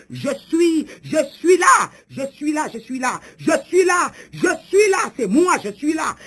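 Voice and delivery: high, insistent shouted declaration; forceful prophetic cry; triumphant proclamation. Acted. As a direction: proclaim your presence in repeated bursts. God announces his arrival, shouting.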